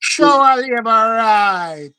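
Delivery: very slow, stretched slogan; drawn-out party cheer. Real, not acted. Host savouring the party slogan, drawn-out cheer. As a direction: draw every word out like a rallying chant.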